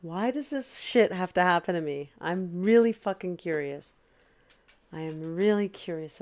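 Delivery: loud, fed-up rant at the computer; real angry outburst; angry venting. Real, not acted. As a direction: shout your frustration at the machine. Mouse broken, angry venting.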